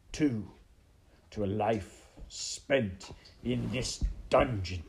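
slow, deliberate villain's pronouncement; menacing villain rat. Acted. Rat consigning the princess to the dungeon, slow menace. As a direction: draw out each phrase like a gloating villain.

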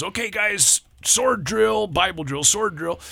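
high-pitched acted announcement to the group; calling out a drill. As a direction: imitate a youth leader rallying the boys. Leader calling the boys to drill.

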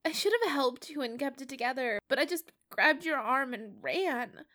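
put-on a scared kid blaming himself; upset, distraught. A narrator's reading. Peter insists to Matt it was his fault, high and distraught.

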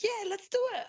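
high, eager yes; husband jumping at the surrogacy offer, eager; imitating eager husband. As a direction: bounce up, keen to say yes right away. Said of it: "And Daniel's like".

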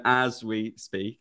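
very slow "as we speak", each word spaced; slow mock-formal "as we speak"; slow deliberate repeat. Shown as a demonstration. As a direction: drag out "as we speak" for the record. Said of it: "can you repeat that as well".

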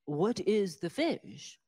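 slow, drawn-out condescending question; mock snooty. Snobby customer repeats the question to the waitress, slow and affected. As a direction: spell it out like a superior snob. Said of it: "I said".

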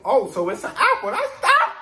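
high, loud squeaky mock voice; hammed-up high mock of the clueless crowd; mocking quote. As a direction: squeal it out like a baffled fool. Mocking their confused reply.

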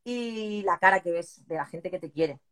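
normal talking voice

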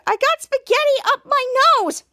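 high, loud whiny complaint; distressed wail. Retold or imitated. Piper wailing to Jasper about spaghetti, high and loud.

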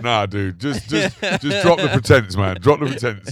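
loud, high exasperated plea; mock protest. Telling a friend to drop his new accent, loud mock protest. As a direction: cry it out as if begging a friend to stop.

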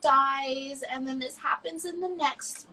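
lightly mocking high spoiler's voice; a hint of a smug spoiler; imitating a rude stranger, lightly mocking. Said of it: "you come in here and go".

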